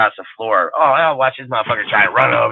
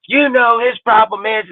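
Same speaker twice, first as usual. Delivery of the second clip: high, loud mock voice of a clueless Raiders fan; squeaky mock football fan whining; mocking dumb fan; 11 semitones higher, 4 dB louder than usual. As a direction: ham up the know-nothing fan, pitched way up and loud. Mocking the fan's take again.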